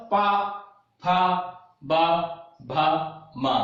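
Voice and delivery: पा, फा, बा, भा, मा said singly with short pauses; syllable drill. Shown as a demonstration. Teacher demonstrates lip sounds to class, syllable drill of पा, फा, बा, भा, मा. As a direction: sound out the lip consonants one by one, पा through मा. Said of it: "अब इसका उच्चारण करिए".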